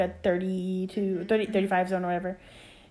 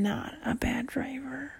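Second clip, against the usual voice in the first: whispered aside; 3 semitones higher, 9 dB quieter, slower than usual. Real, not acted. Defensive aside about driving.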